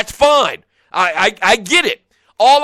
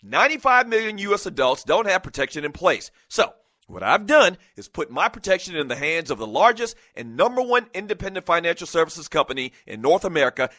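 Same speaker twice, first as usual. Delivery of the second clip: softer, calm sponsor read; 4 semitones lower, 4 dB quieter than usual. Acted. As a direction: read the ad sincerely. Reads sponsor ad.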